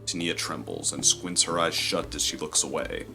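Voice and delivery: ordinary talk, nothing marked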